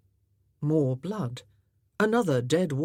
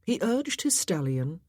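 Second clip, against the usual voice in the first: plain reading voice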